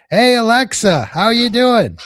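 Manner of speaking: high, loud hail to a device; calling out. Shown as a demonstration. Host calls to Alexa to tease listeners, loud hail. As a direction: holler it across the room.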